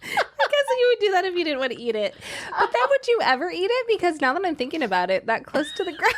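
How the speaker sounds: high, laughing through each phrase; giggling while talking. Real, not acted. Laughing at candy anklets.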